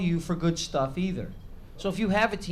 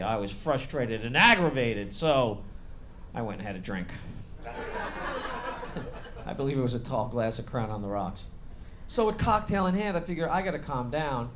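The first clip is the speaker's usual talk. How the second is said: usual speaking voice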